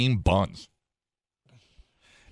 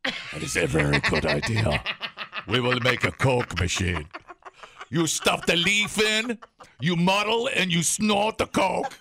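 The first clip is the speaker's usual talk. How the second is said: hammed-up foreign accent, slow and high; playing a South American listener; mock South American accent; 7 semitones higher than usual. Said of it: "some guy in South America right now going".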